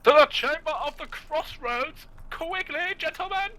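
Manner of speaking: rally the troops in a silly high voice; squeaky heroic call. Pretend. Mock heroic call to companions.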